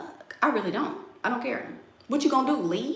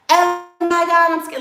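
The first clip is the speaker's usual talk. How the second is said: mock scared; 9 semitones higher, 6 dB louder than usual. Mocking women afraid he finds out, hammed-up fear. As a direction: ham up a frightened girlfriend. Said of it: "y'all out here getting mistreated".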